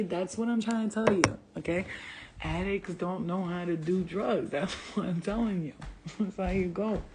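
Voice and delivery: normal talking voice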